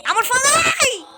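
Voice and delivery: high cry of panic; alarmed shout. Real, not acted. Player appealing to the alien, alarmed shout. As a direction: cry out to stop him, pitch soaring.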